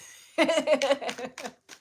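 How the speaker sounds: high loud laugh breaking out; loud burst of laughter. Real, not acted. After mocking her own comforting, a loud laugh.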